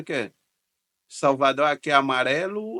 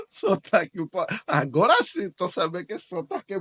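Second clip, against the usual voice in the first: laughing; 8 semitones higher than usual. Real, not acted. Laughs at paulistano clip.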